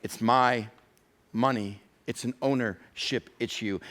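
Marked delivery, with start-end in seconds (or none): none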